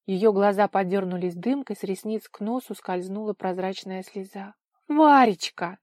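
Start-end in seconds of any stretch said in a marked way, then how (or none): 4.9-5.8 high, loud, affectionate cry; moved exclamation. A narrator's reading. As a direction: cry out the pet name with feeling. Woman tearfully embraces the girl. (растроганно, высоко и громко)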